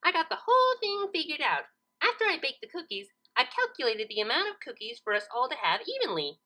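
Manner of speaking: high, chirpy pony voice; proud of her plan; Pinkie voice. Acted. Pinkie proudly tells Twilight she has it figured out.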